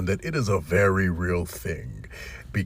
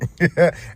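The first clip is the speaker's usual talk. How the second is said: laugh; 8 semitones higher, 6 dB louder than usual. Real, not acted. Laughing at own joke.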